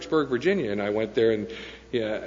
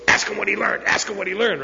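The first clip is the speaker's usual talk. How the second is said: put-on high, raised, insistent old-timer; pushy mock command in a higher voice; imitated urging; 9 semitones higher, 3 dB louder than usual. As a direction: egg him on, repeating it insistently. Old-timer urging him, retold.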